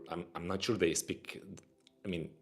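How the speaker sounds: normal talking voice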